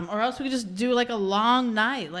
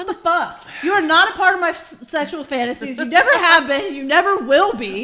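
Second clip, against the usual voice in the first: loud, high tirade with laughter creeping in; mock-furious rejection; 7 semitones higher, 8 dB louder than usual. Real, not acted. Woman barring co-host from her fantasies, loud mock outrage. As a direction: shut them down hard, half-amused.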